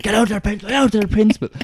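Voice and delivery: high shouted order; mock fury. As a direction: rant it at the pupil. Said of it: "absolutely lost it".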